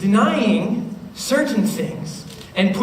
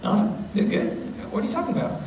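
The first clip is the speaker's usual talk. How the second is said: quiet, half-whispered denial; hushed brush-off; 7 dB quieter, faster than usual. Retold or imitated. Mark brushes off a worried friend, hushed denial. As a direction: wave it off under your breath.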